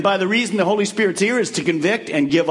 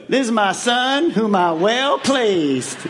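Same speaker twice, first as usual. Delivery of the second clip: slow Southern Georgia drawl, lightly done; a folksy Georgia accent as the voice of God; 3 semitones higher, slower than usual. Pretend. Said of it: "what if God's from Georgia".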